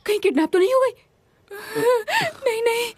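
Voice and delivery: high, alarmed question, then anxious denial; rising panic. Acted. Panicking that someone was kidnapped, high and alarmed. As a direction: let the worry leap up into high-pitched panic.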